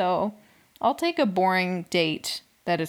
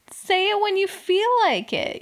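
exasperated; 11 semitones higher, 4 dB louder than usual. Real, not acted. Exasperated advice.

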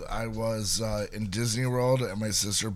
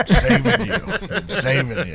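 laughing mock rescuer; 6 semitones higher, 7 dB louder than usual. Voicing the rescuer mockingly.

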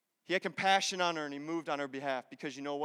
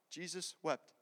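quiet, matter-of-fact delivery, much softer; understated verse quote; 2 semitones lower, 12 dB quieter, slower than usual. Shown as a demonstration. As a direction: say it softly and simply. Shortest verse, first said simply.

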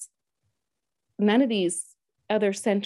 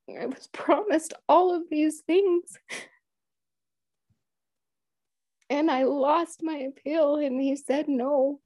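heartfelt plea; 5 semitones higher than usual. Retold or imitated. Telling Papa Lake her hurt, a pleading retold prayer. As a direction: pour your heart out, lightly voiced. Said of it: "pouring my heart out".

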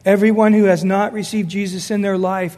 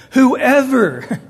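Whoever punched louder; stress on Whoever; 4 semitones higher, 5 dB louder than usual. Shown as a demonstration. Preacher drives home Whoever to the church, stressed. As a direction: lean hard on Whoever. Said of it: "it's very emphatic".

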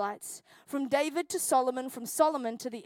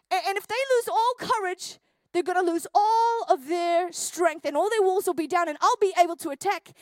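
louder and higher, rising to a preaching peak; building sermon intensity; 3 semitones higher, 4 dB louder than usual. Acted. Preacher warning the congregation about the enemy, building intensity. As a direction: drive the point home, building.

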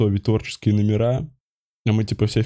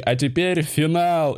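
slow, raised showman voice; grand host announcement; 4 semitones higher, slower than usual. Retold or imitated. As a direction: announce it like a game show host. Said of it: "а мы такие".

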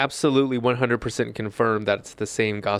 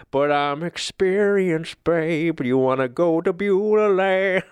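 sultry [name] impression, slow and higher; 6 semitones higher, slower than usual. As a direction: vamp it like [name]. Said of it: "become [name] when singing".

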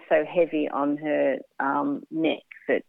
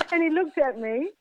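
laughing; 11 semitones higher than usual. Real, not acted. Still laughing as she narrates.

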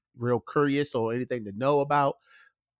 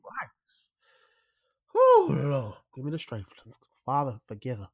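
praying for patience through teeth; slower than usual. Acted. Mock exasperated prayer.

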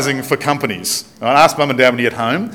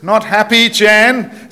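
broad Australian, higher and punchy; Aussie ad line; 9 semitones higher, 4 dB louder, slower than usual. Retold or imitated. Preacher quoting the Yellow Pages ad in Australian. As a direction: quote the ad in a broad Australian accent. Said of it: "It's a Yellow Pages ad".

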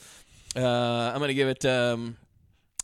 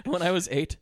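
higher, amused through laughter; laughing; 6 semitones higher than usual. Real, not acted. Co-host topping the babysitter joke, real laughter. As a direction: say it while cracking up.

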